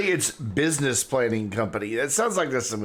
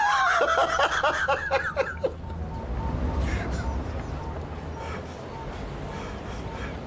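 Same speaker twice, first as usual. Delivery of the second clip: long, high burst of laughter; real helpless laugh. Real, not acted. Gamer reading a silly business name, real helpless laugh. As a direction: crack up and keep laughing. Cracks up at funeral home name.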